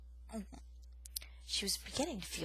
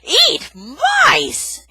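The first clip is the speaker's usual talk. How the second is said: very loud, high outraged cry; disgusted exclamation; 19 dB louder than usual. Acted. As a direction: explode in disgust. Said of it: "Aerith exclaimed".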